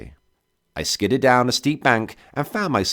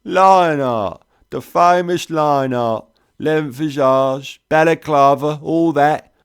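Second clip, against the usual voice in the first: thick cockney; 7 semitones higher, 5 dB louder than usual. A narrator's reading. Trader sizes up the famous soldier, sly loud cockney. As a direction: savour the name like a sly cockney. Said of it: "cockney thick as fog on the Thames".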